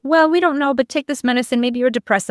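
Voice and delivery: a hint of a dismissive doctor; mock doctor. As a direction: brush her off like a doctor. Doctor voiced in retold story.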